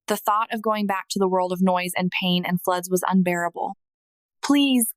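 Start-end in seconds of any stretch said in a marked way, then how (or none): 4.4-4.9 begging. Retold or imitated. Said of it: "I begged him".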